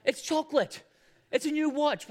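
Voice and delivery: put-on high, loud excited-child shouts; imitating yelling kids. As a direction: blurt it out like an overexcited kid at Christmas. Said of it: "and they just yell".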